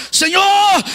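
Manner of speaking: high, loud tearful cry; crying out to the Lord. Retold or imitated. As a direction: cry out to God on your knees. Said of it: "e eu chorando".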